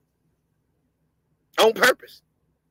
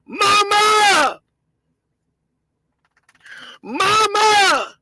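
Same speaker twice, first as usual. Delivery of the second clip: imitated crying plea; 5 semitones higher, much slower than usual. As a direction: wail for mama like the man on the tape. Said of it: "footage of him saying".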